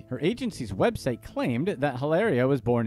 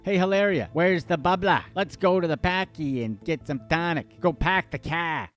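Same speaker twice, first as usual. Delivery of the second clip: mock Boston accent; 3 semitones higher than usual. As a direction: tease her with an over-the-top Boston accent. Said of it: "we always thought the Boston accent was wicked cool".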